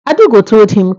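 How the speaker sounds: ordinary delivery, nothing marked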